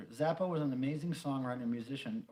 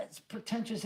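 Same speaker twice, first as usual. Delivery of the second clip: ordinary talk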